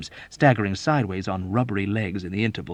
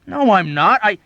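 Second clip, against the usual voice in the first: loud insisting; 12 semitones higher, 12 dB louder than usual. Acted. Dobbs insists loudly.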